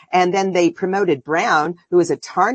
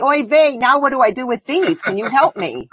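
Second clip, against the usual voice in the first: hint of a Yiddish accent, higher; lightly put-on Yiddish plea; 6 semitones higher than usual. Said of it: "go to the Knesset and say".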